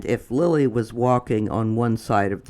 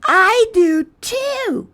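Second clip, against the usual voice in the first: very high, slow, louder character voice; Chester's voice; 7 dB louder, slower than usual. Acted. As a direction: draw it out way up high. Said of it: "said Chester".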